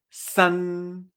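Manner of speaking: san with a front n; Mandarin san. Shown as a demonstration. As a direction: close on the n of san. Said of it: "Que es con N normal".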